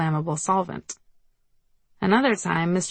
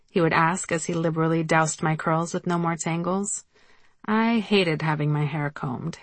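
ordinary delivery

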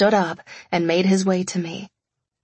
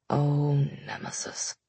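soft fond sigh; 4 semitones lower, 8 dB quieter than usual. A narrator's reading. Wolf fond exasperation.

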